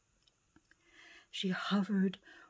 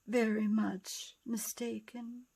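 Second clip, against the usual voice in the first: ordinary delivery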